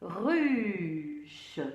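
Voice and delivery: "Ruche" with a long falling vowel, then ch; drawn-out sounding out. Shown as a demonstration. Teacher confirming the spelled word to pupils, drawn out. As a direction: stretch the vowel of "Ruche" before the ch. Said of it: "on va bien décomposer".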